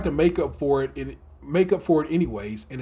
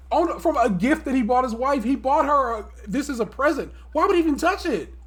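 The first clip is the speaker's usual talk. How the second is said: incredulous protest; 8 semitones higher than usual. Real, not acted. Astounded that he ate the gift.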